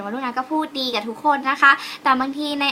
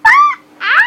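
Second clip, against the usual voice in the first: loud, high-pitched playful squeak; excited put-on high voice; 8 semitones higher, 7 dB louder than usual. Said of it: "ทำน้ำทำเสียงสูง".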